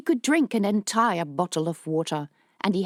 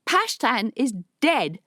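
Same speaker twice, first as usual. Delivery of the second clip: called out; 6 semitones higher, 3 dB louder than usual. Acted. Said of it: "called out over the din".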